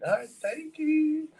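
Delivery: high sing-song thanks to a tipping customer; mock polite bartender. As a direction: sweetly thank the customer, drawn out.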